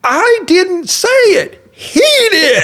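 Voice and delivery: slow, high, loud laughing denial; laughing protest. Retold or imitated. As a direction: protest innocence through laughter. Defending himself to glaring wife.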